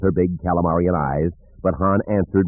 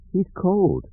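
mumbled; 12 semitones higher than usual. A narrator's reading. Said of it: "Jaina mumbled".

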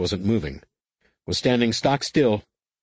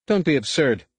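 scoffing, pitched up; snorting dismissal; 6 semitones higher than usual. Acted. Chief of State scoffs at Luke, snorting. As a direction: brush him off with a snort. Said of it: "Failure snorted".

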